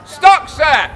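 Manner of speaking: booming public proclamation; town crier. Acted. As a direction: proclaim it to the whole square. Crier asking question.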